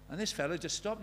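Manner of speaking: ordinary talk, nothing marked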